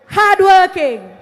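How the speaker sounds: "hardworking" slow and loud, each syllable hit; emphatic word. Acted. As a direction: punch out "hardworking". Emphasis in rally speech.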